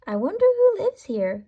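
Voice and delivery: put-on a light girlish wonder at the door; lightly voiced little girl; Goldilocks wondering. A narrator's reading. Goldilocks's thought voiced aloud.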